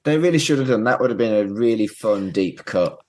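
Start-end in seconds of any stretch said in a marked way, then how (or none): none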